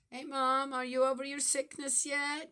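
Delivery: light imitation of her daughter calling Mom; imitating daughter. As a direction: play the daughter's cheery text. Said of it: "youngest daughter".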